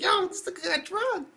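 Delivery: very high-pitched exclamation; mock shocked outcry. Reacting to the spiked coffee, high mock-shocked outcry. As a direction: squeal it out in disbelief.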